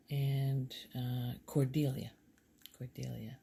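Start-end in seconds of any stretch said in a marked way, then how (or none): none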